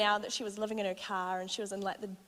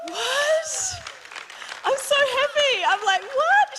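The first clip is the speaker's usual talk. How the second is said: much higher and louder joyful cry of "what?"; overjoyed shock; 9 dB louder, slower than usual. Real, not acted. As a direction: burst out in real delight. Mum says she found Jesus.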